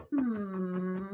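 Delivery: soft, sinking closed-lip hum. Shown as a demonstration. Coach patting own rib cage, soft hum. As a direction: hum gently downward and hold. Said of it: "I'm gonna add a hum".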